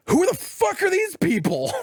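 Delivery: high-pitched acted bewilderment; alarmed disbelief. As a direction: act out finding strangers' gear, pitch jumping up. Acting Ellie seeing strangers' sleeping bags.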